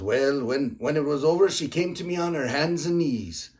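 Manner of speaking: high, loud, hammed-up Irish brogue; terrible Irish accent. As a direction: build up the punchline in a mock Irish voice. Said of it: "terrible, uh, accent".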